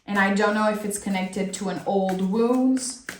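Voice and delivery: normal talking voice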